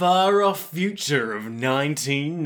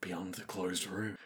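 eerie whisper; 6 semitones lower, 16 dB quieter than usual. Acted. Spooky mock-horror voice.

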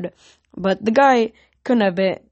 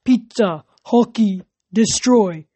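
one emphatic word at a time; simple-minded lug listing words; dim brute; 2 semitones higher, 4 dB louder, slower than usual. A narrator's reading. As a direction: punch out each word separately. Dim ox offers short words.

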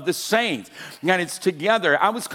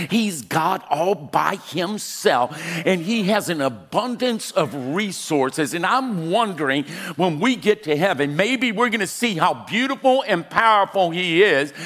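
raised, emphatic pulpit delivery; full-force preaching; 5 semitones higher, 5 dB louder than usual. Real, not acted. Preacher telling the church God provides, impassioned preaching. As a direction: drive it home to the congregation, building higher.

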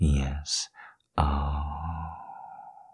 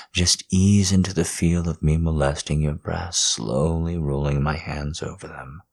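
usual speaking voice

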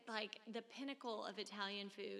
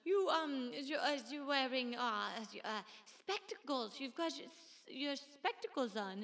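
put-on higher, halting Italian-accented concierge; little Italian voice; 4 semitones higher, 6 dB louder than usual. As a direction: imitate a polite Italian concierge groping for words. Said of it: "in his little Italian voice".

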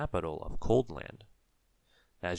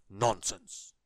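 dismissive wizard snort; snorting dismissal; 4 dB louder than usual. A narrator's reading. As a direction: scoff it off like a grumpy wizard. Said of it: "snorted the wizard".

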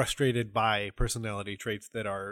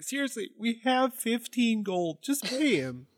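high, put-on whiny plea, building louder; pleading homunculus; 13 semitones higher, 5 dB louder than usual. Homunculus begging during a failed transaction, pleading.

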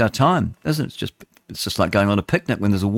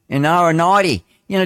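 normal talking voice

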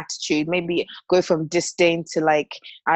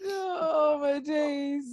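laughing exclamation; 7 semitones higher, much slower than usual. Real, not acted. Overcome by co-host's drinking hack.

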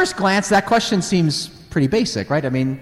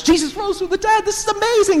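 much higher, thrilled cry; excited disciples; faster than usual. Retold or imitated. Disciples after the resurrection, thrilled and much higher. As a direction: burst out with the news, delighted. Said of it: "He's excited".

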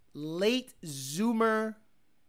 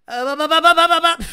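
imitating engine revving; 8 semitones higher, 15 dB louder, faster than usual. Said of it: "I think he calls it Nemo".

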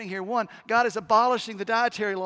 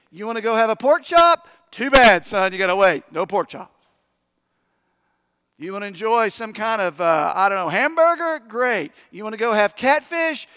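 louder, punchy preacher's rhetorical questions; preaching at full force; 8 dB louder than usual. Acted. Preacher driving home the food laws to the congregation, loud and fervent. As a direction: preach it hard, hammer each food.

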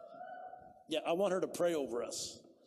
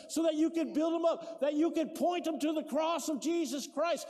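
louder, higher preaching, shouting the key lines; full-force preaching; 9 semitones higher, 5 dB louder than usual. Acted. Pastor telling the grieving widow why she is still here, preaching loud. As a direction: drive it home like a revival preacher.

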